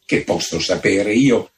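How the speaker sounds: usual speaking voice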